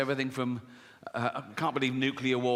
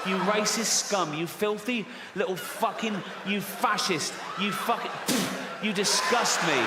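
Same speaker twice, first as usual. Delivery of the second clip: mock furious rant; 3 semitones higher than usual. Comic rant at little Timmy.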